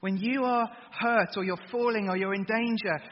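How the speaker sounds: ordinary delivery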